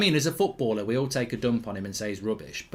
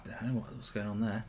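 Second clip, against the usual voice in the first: ordinary delivery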